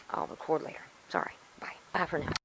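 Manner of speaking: tired whisper, fading out; whispered sign-off. Real, not acted. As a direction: whisper it, worn out and sore. Signing off, tooth aching.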